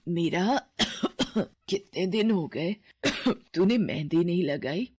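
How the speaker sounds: ailing mother coughing between words; sick coughing. Acted. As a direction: cough weakly as you ask. (बीमार माँ खाँसते हुए पूछती है)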